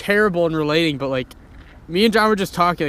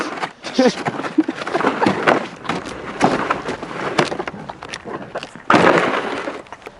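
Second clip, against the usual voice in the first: high unvoiced wheezing laugh; breathless laugh; 10 semitones higher than usual. Real, not acted. Laughs after "But I am.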